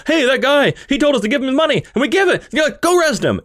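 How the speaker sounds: high, hurried mock outcry; acted bank staff. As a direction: play duped tellers raising the alarm. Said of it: "kinda wake up and they're like".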